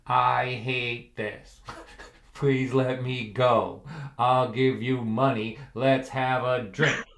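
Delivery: very slow, spaced-out words, slightly low; deadpan translated message. Acted. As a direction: read a tapped-out plea word by word. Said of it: "This is the translation".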